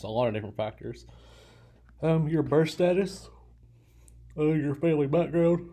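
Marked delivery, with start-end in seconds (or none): 2.0-5.7 talking through a yawn; higher, stretched yawning words. Real, not acted. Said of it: "I always yawn".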